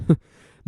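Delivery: feeble little laugh; weak chuckle. Acted. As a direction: chuckle weakly. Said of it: "a weak chuckle".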